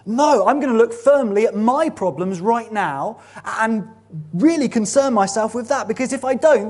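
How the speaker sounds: hammed-up stubborn refusal; stubborn worrier. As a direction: dig in and insist on worrying. Voicing the anxious stubborn believer.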